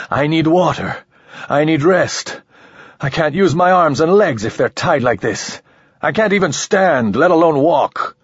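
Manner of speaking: he tries to stay calm but it climbs; voice rising again. A narrator's reading. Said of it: "He heard his voice rising again".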